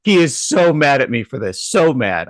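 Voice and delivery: usual speaking voice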